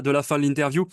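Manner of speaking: ordinary delivery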